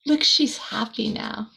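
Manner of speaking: ordinary delivery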